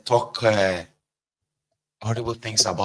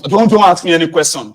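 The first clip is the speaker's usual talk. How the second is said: angry snap; 6 semitones higher, 11 dB louder than usual. Retold or imitated. Storming out, snapping at family.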